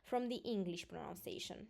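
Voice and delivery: normal talking voice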